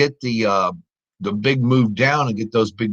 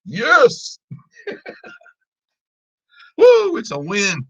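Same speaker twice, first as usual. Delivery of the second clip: victory cheer; 13 semitones higher, slower than usual. Real, not acted. Trader cheering a closed winning trade, high whoop. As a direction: shout the win out.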